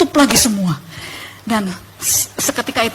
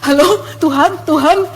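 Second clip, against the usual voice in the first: polos; 3 semitones higher than usual. Retold or imitated. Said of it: "masih polos ya kan".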